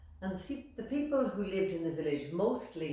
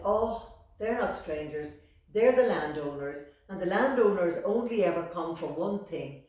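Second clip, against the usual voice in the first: scoffing mother; 2 semitones higher, 11 dB louder than usual. Acted. Mother scoffing about landowners.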